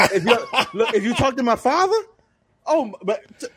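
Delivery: laughing, high voice. Real, not acted. Laughing about his father.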